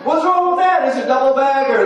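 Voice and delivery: a hammed-up husband defending his gift; defensive husband. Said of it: "husband defends gift".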